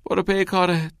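high, slightly strained dismissal; a touch of hoarseness, pitched high; hoarse, choked. A narrator's reading. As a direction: send him away in a choked voice. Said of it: "صدایش خشدار و گرفته بود".

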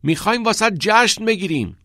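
high, loud call; loud happy yell; shouting. A narrator's reading. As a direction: shout the party plan over the crowd. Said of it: "ناکس داد زد".

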